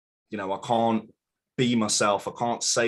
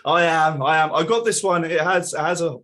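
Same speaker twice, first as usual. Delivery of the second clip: excited; 9 semitones higher, 7 dB louder than usual. Real, not acted.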